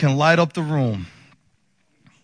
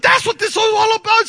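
much higher, loud and startled; high-pitched realization; 4 dB louder than usual. Retold or imitated. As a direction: imitate the wife's squealed realization. Wife realizes it is her shower.

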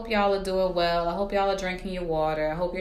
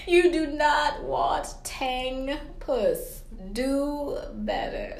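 high, slow sassy lecture; sassy scolding; 9 semitones higher, much slower than usual. Acted. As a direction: drag it out, scolding higher. Scolding joke to listeners.

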